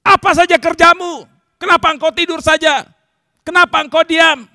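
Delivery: high, loud accusing yells; angry shouting at God. Acted. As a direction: hurl each question as a furious rebuke. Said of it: "marah-marah kepada Tuhan". (teriak marah kepada Tuhan)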